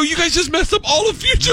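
very high-pitched comic dismay; exasperated dad. Acted. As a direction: act out a dad at his wits' end, amused. Dad exasperated with clueless daughters.

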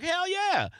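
happily agree, no fuss; bright mock-cheerful yes; eager agreement. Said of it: "And I'd been like".

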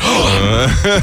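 big mock gasp of realization; starstruck gasp. Fan told to tweet his idol, starstruck gasp. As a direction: gasp in as the idea lands. Starstruck reaction to friend's suggestion.

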